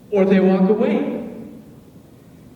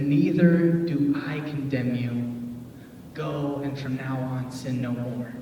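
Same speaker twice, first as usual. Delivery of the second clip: tender Jesus; 6 semitones lower, 6 dB quieter than usual. Retold or imitated. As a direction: say it like a quiet blessing. Jesus speaks gently.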